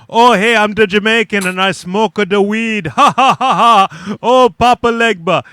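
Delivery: hammed-up fake Jamaican accent with a cackle; a deliberately bad Jamaican accent, mocking; mocking Jamaican impression. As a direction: ham up a stoner Jamaican caricature, laughing. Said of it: "If you started saying".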